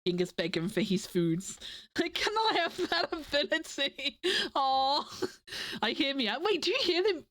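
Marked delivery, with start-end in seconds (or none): none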